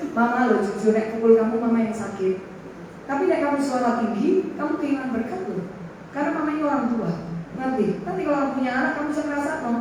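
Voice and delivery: soft, low motherly talk; tender counsel. Retold or imitated. Mother counsels her son after dinner, soft and tender. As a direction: explain gently, holding the child close.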